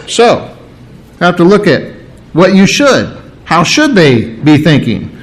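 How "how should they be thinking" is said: normal talking voice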